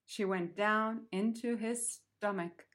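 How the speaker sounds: plain reading voice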